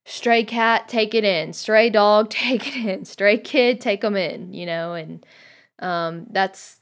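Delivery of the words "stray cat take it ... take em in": louder, higher, rhythmic list; raised-voice, insistent welcome; emphatic listing. Acted. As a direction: punch out each pair eagerly. Voicing women who welcome all.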